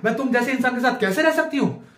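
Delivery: a hint of girlfriend outrage; mocked girlfriend. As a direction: lightly mimic a dismayed girlfriend. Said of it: "जिस दिन साला Batman बन गया".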